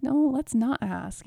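a hint of a prim good girl. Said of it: "my good girl stepping in".

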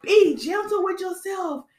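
high, drawn-out reaction of revelation; moved realization. Retold or imitated. Vlogger reliving advice from a show, high awed realization. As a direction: coo it slowly, as if struck by it.

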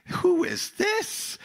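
slow, very high-pitched disbelief; astonished question. Acted. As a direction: ask it high, like a stunned disciple. Acting out terrified, amazed disciples.

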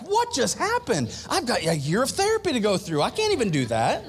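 lightly playing a stunned wife; mock bewildered wife. Voicing bewildered women.

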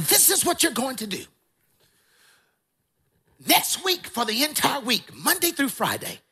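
put-on old preacher laying down instructions; strong-voiced old elder. Elder giving a jobless young man orders, strong high-pitched voice. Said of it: "that's how he taught".

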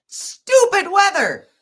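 slow, squeaky outburst about the weather; exasperated. Real, not acted. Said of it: "I can't be pleasant during this".

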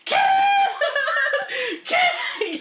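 very high, loud scream broken by laughter; laughing scream. Retold or imitated. Starstruck fan screaming at Kim, laughing. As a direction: scream her name, laughing. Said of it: "I just started screaming".

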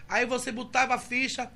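usual speaking voice

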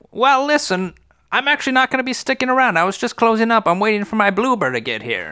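high, casual nun; breezy high-pitched brush-off. Acted. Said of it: "the nun is like".

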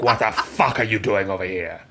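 put-on [name] accent, a touch low; mock-indignant [name] accent. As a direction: imitate [name] telling someone off in her accent. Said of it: "What is that accent you just gave [name]?".

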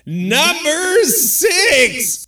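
slow, high announcer call; drawn-out countdown call. Acted. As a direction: stretch it out like a game show host.

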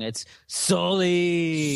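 Sully said slowly and carefully; corrected pronunciation. Shown as a demonstration. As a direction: spell out how Sully sounds. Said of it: "you said the movie title wrong".

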